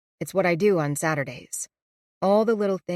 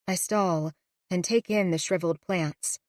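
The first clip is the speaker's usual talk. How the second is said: ordinary delivery, nothing marked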